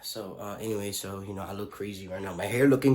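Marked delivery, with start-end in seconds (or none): none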